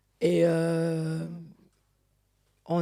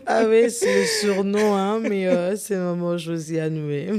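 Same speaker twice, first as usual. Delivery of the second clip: laughing; 4 semitones higher, 10 dB louder than usual. Real, not acted. Guest still laughing at nickname.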